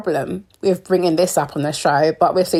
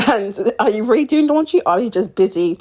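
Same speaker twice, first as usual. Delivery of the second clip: laughing; 6 semitones higher than usual. Real, not acted.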